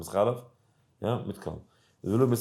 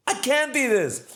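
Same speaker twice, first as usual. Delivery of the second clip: high, loud dismayed outcry; dismayed cry; 13 semitones higher, 4 dB louder than usual. Retold or imitated. Man leaving the gas station with the burrito, high loud outcry. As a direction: cry it out high as the bad news hits.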